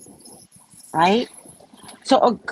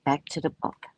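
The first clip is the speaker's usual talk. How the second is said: ordinary talk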